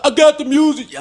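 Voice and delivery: high, loud imitation of a defensive friend; mock defensive yell. Mimicking friend's shouted answer.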